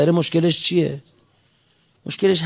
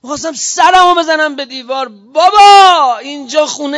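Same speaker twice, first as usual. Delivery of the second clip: exasperated outburst; 6 semitones higher, 7 dB louder than usual. Real, not acted. Preacher protesting at an official's dismissal of the village, exasperated. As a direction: burst out in indignant protest.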